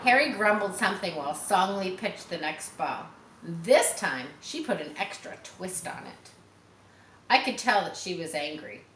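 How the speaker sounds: usual speaking voice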